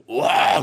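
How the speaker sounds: loud wordless roar of rage; burst of anger. Pretend. Preacher voicing God's anger bursting out, a loud roar. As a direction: erupt with a loud 와. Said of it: "하면서 나오신 거예요".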